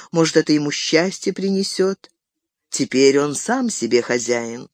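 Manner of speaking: ordinary delivery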